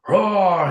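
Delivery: a high, loud mock grizzly roar; bear roar. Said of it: "he says".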